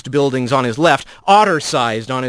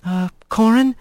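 calm her friend down; gentle interruption; 6 semitones higher than usual. Acted. Kylie interrupts Corin gently.

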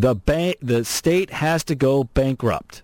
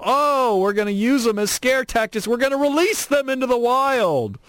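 high, hammed-up scaremongering voice; mocking, high wail of fake doom; mock alarm; 10 semitones higher than usual. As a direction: mimic officials whipping up panic, pitched way up. Mocking officials' scare tactics.

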